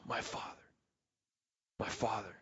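breathy whisper, intimate and low; whispered prayer. Shown as a demonstration. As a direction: pray it under your breath to a father. Demonstrating a personal prayer.

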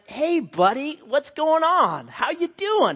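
put-on high sing-song talk to a toddler; bubbly, drawn-out phone hello; chirpy dad greeting. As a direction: greet your little kid on the phone, stretched and high.

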